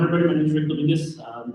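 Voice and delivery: usual speaking voice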